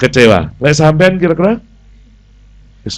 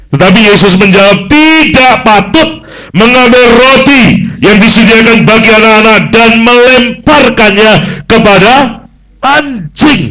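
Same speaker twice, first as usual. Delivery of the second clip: emphatic scripture reading; 10 semitones higher, 6 dB louder than usual. Acted. Preacher reading Jesus' reply to the congregation, loud and proclaiming. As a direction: proclaim the verse with force. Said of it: "Ayat dua puluh enam berkata begini".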